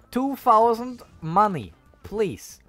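ordinary talk, nothing marked

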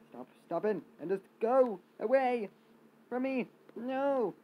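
high loud panicked yelling; panicked shout. Real, not acted. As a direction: shriek at them to back off. Enemies close in.

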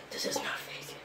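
frightened hushed whisper; scared whisper. Real, not acted. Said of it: "I'm so scared".

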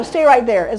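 usual speaking voice

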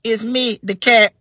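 high simple voice; slow brother. Acted. John answering the old man, high and simple. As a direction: answer earnestly like a simple kid. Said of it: "John said".

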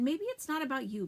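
scolding. Acted. Arno scolding Sophie.

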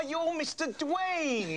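very slow, savouring the name; drawn-out name. Acted. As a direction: drag out Dwayne. Stretching the name.

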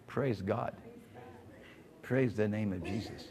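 low, soft praise with long pauses; murmured prayer. Real, not acted. Preacher praises and thanks Jesus, hushed prayer. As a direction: give thanks softly, phrase by phrase.

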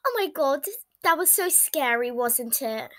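usual speaking voice

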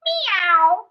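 drawn-out imitated cat meow; frog's meow. A narrator's reading. Storyteller introducing a frog that meows, imitated meow. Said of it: "a frog that went".

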